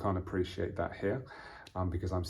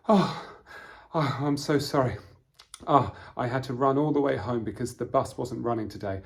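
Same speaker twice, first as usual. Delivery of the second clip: acted puffing, higher and louder; out of breath; 4 semitones higher, 5 dB louder than usual. Late arrival apologising after running home, acted breathlessness. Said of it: "To be out of breath".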